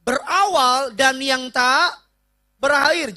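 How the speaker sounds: high, slow preached declaration; preacher declaring God beginning and end, high and slow; solemn proclamation. Acted. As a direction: proclaim God's eternity slowly.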